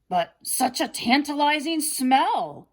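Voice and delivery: drooling wolf. A narrator's reading. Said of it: "the wolf drooled".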